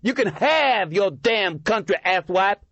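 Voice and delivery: put-on high, loud drunken yelling. A narrator's reading. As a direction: yell it back, drunk and vicious. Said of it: "the drunken man yelled back".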